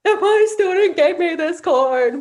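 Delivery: high, loud, slow, hammed-up crybaby imitation; mocking teens. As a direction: mimic mom's tearful line to tease her. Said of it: "they were mocking me".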